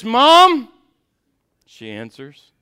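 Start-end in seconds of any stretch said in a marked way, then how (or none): 0.0-0.7 high, loud yell for mom; calling out. Retold or imitated. Brother calling mom a third time, loud and insistent. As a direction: holler for mom like a determined kid.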